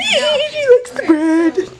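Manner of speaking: very high, drawn-out happy squeal; delighted squeal. Real, not acted. Celebrating that the child eats.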